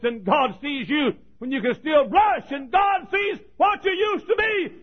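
rising, high and loud, building to a shout; preaching at full force. Acted. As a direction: hammer each line harder like a revival preacher.

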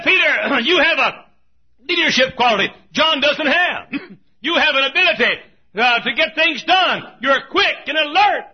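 high, full-voiced shouted praise; preacher's shout. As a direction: shout it out to Peter. Preacher voices Jesus praising bold Peter.

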